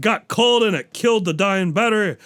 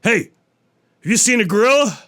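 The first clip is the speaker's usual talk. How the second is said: higher, louder demand; angry lion; 6 semitones higher, 5 dB louder than usual. Acted. Lion at the tent hunting the gorilla, loud and high. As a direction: barge in demanding answers. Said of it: "the lion goes".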